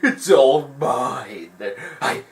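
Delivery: mock villain losing his prize, ending in a shriek; Jafar gloating. Jafar losing the lamp, in a hammed-up villain voice. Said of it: "he was like".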